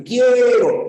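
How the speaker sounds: "Quiero" as QUIÉÉ-ro, high and loud on E; stress on E. Shown as a demonstration. Teacher showing the diphthong, stressed long E in "Quiero". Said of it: "la fuerza de voz está en la E".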